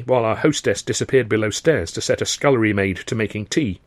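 ordinary delivery, nothing marked

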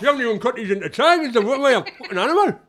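hammed-up northern husband, indignant; northern accent. Husband texting his wife about the sandwiches, loud northern accent. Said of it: "I forgot that up north".

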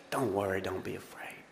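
tender whispered don't be afraid; comforting whisper. Real, not acted. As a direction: breathe it out as gentle reassurance. Softly repeats comforting words.

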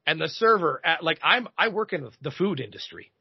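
usual speaking voice

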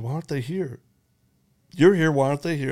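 imitating the imam's pointed challenge; insistent retold question. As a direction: push the question back at him.

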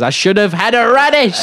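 high, loud lament; character voice. Acted. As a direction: cry out the regret in a character voice. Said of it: "Miriam Margolyes says".

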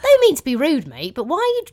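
challenging a boasting cab driver, loud and incredulous; incredulous challenge. Retold or imitated. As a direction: call his bluff, loud and high.

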